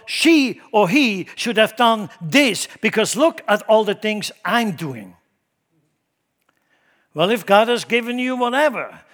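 loud, high, ringing sermon delivery; impassioned preaching. Real, not acted. Preacher exhorting the church not to compare gifts, full-force preaching. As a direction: preach it hard, stretching the key words.

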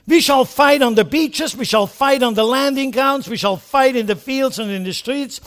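loud, high battle oration; defiant wartime rally; Churchill quote. Retold or imitated. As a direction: build the we shall fight litany. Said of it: "leaders like this, talking like this".

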